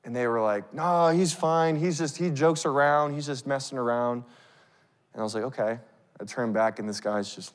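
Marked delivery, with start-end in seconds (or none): none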